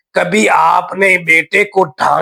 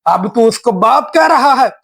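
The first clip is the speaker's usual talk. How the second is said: fast, higher angry yell; furious shout; 4 semitones higher, faster than usual. Acted. Father yelling at his son to come here. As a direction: call the son over in a rage.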